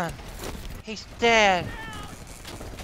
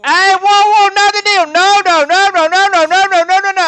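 higher, louder panicked no's; getting a needle; 6 semitones higher, 5 dB louder than usual. Pretend. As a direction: beg them to stop the needle. Strapped down for injection.

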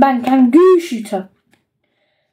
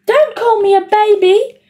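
put-on higher, whiny kid voice; whiny little brother; 7 semitones higher than usual. A narrator's reading. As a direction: protest like a hurt little boy. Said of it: "said Perfect Peter".

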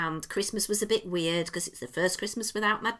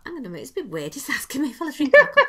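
incredulous thought; 4 semitones higher, 8 dB louder, much faster than usual. Retold or imitated. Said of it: "And I thought".

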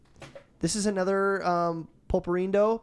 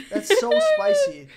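much higher, louder, drawn-out cry; high pained squeal; 8 dB louder than usual. Real, not acted. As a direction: squeal it as the heat hits. Mouth burning from candy.

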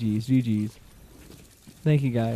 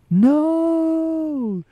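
high, loud cry of no; anguished gamer yell; dismayed shout; 6 dB louder than usual. Real, not acted. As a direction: yell it as the play fails. Missed the reboot card.

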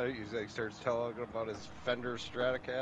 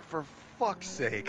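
slow, higher-pitched exasperated quote; mock exasperation; 5 semitones higher, much slower than usual. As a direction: drag it out, imitating a fed-up friend. Quotes Fish's exasperated reaction.

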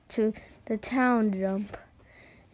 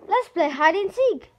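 put-on higher, louder boy's invite; Buzz's voice; 8 semitones higher, 4 dB louder than usual. Boy inviting his pet fly to play, higher. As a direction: call out like an eager boy. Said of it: "said Buzz".

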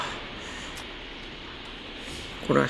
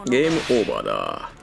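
deep menacing villain declaration; low, ominous game over. Acted. (低く不気味な悪役の声)

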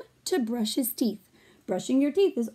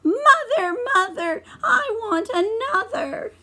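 high, louder wailing cry; high sobbing baby voice; crying baby mouse; 12 semitones higher, 5 dB louder than usual. Acted. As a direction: wail like an unhappy baby. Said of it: "But Baby Mouse said".

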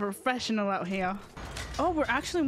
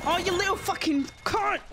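sudden high yell at the enemy; angry outburst; 8 semitones higher than usual. Real, not acted. Furious at enemy in match.

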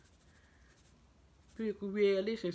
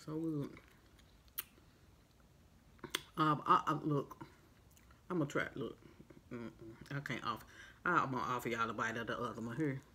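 talking while eating hot dog; muffled through food bites; mouth full chewing; 4 semitones lower, 5 dB quieter, faster than usual. Real, not acted. Seen 1: holds hot dog at mouth, biting and chewing throughout words.

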